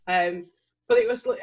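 normal talking voice

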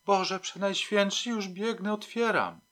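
high, alarmed cry; frightened calling. A narrator's reading. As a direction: call out in fright from inside the flat. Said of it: "przerażone wołanie z głębi mieszkania".

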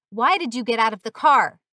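loud, angry demand; shout. A narrator's reading. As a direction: shout the question like an irate policeman. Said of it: "he shouted".

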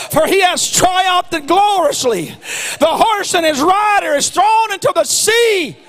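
rising, shouted preaching with a laugh; preaching at full force. Acted. Preacher rousing the congregation, shouted high.

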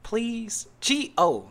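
usual speaking voice

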